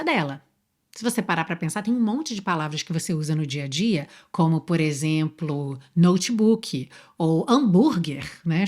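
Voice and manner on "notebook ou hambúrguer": notebook ou hambúrguer said the Brazilian way; Brazilian pronunciation. Shown as a demonstration. Teacher showing Brazilian loanword pronunciation, slowly. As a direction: say notebook ou hambúrguer like Portuguese words. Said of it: "com a pronúncia brasileira".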